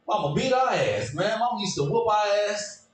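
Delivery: normal talking voice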